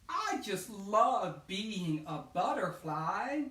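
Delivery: high, loud, slow voice of a delighted butterfly; joyful butterfly. Acted. New butterfly telling the meadow he can fly, high and thrilled. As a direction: soar with wonder on every word.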